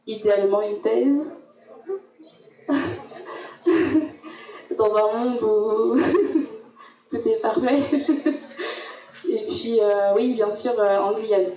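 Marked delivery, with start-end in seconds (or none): 2.7-4.7 an embarrassed little laugh; short real laugh. Real, not acted. Laughs at own ambition. 4.8-7.8 joking wistfully, half laughing. Real, not acted. Joking about ideal world. 7.9-8.9 quiet laugh trailing off; soft breathy laugh. Real, not acted. Laughs after joke.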